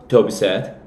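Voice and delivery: ordinary delivery, nothing marked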